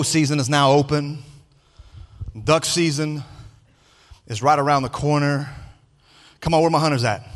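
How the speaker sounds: down-home Louisiana preacher talk; Louisiana vernacular. Pretend. Preacher rallies the hunters in church, Louisiana vernacular. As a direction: put some Louisiana vernacular on it. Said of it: "some Louisiana vernacular on this".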